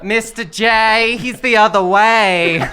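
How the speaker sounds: put-on slow, drawn-out muddle of accents; Harley Quinn with a confused accent; weird combination of accents. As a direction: draw it out in mixed-up accents. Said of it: "That was a weird combination of accents".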